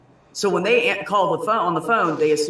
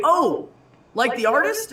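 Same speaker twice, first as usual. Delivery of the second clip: high, surprised patient's voice, lightly done; a patient perks up and asks, slightly higher; imitating surprised patient; 11 semitones higher, 3 dB louder, slower than usual. Patient on the phone recognising the name, mock surprise.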